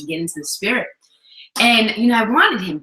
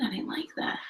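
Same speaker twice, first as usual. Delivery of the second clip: soft breathy whisper; a hushed, tender admission to the husband; 14 dB quieter than usual. Retold or imitated. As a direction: whisper it gently, no voice. Retelling gentle complaint to husband.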